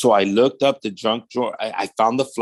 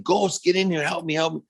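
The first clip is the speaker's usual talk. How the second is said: retold high, panicky call without shouting; urgent plea; 7 semitones higher than usual. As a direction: imitate calling a friend for help, pitched high. Retold panicked call to friend for help.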